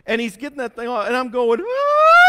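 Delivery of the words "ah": loud high-pitched scream; mock terrified scream. Patient seeing the freezing tool come out, screaming. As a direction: scream as if the tool is coming at you.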